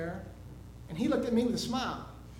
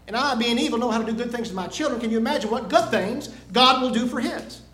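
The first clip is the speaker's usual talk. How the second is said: loud, emphatic sermon delivery; preaching; 3 semitones higher, 8 dB louder than usual. Real, not acted. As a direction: drive the point home to a congregation. Preaches the lesson to congregation.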